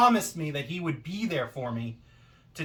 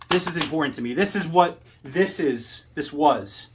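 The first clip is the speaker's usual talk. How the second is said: loud call-out; 2 semitones higher, 5 dB louder than usual. Acted. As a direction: build to a shouted threat at a rival. Promo climax calling out Forrest.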